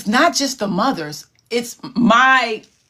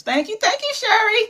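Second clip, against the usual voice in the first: much higher, lilting thank-you; sing-song thanks; 13 semitones higher than usual. Real, not acted. Host thanks Sherry in the chat with a high lilting voice.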